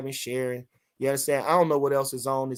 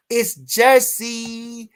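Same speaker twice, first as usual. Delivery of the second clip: slow, drawn-out, higher and louder; hyped Jersey call; 6 semitones higher, 4 dB louder, much slower than usual. Real, not acted. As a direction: stretch it out like a proud shout-out. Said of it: "drawn-out hype call".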